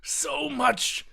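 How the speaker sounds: high, wailing hammed-up dying cry; mock-tragic high cry; dying lament. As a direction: wail it as he dies. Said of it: "he says".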